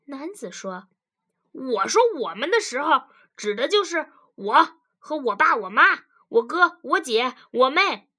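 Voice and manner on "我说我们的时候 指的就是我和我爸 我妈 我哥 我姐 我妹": loud, emphatic listing; explaining husband. Acted. Husband lists his family to his wife, loud and emphatic. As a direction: count them off loudly, one by one.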